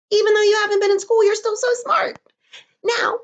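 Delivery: high, fast praise fading into a whisper; gushing praise. Real, not acted. Praising children.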